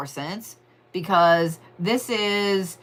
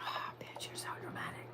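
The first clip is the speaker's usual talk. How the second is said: mock whisper; 21 dB quieter than usual. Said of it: "Virgos are like".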